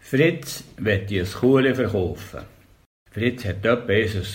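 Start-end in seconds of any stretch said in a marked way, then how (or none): none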